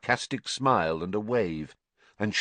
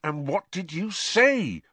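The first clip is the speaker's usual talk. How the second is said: higher, slow, pressing; insistent repeat; 10 semitones higher than usual. A narrator's reading. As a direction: demand the answer again. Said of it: "Ron repeated, pounding his pestle".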